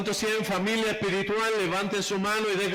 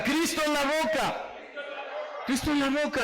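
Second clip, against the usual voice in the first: call and response, again; 6 semitones higher than usual. Shown as a demonstration. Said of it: "Otra vez diga".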